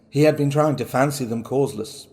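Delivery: plain reading voice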